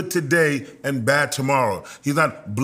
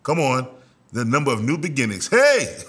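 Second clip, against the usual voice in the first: excited Jesse. Acted. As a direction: hype up the youngest son, then shout hey. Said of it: "He said".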